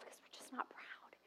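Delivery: whispered aside. Real, not acted. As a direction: lean in and whisper it.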